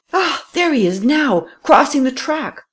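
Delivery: high-pitched cry of discovery; excited cry. A narrator's reading. Pursuer sighting the fugitive crossing the track, high excited cry. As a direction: burst out on spotting the quarry. Spots the fleeing thief, cries out.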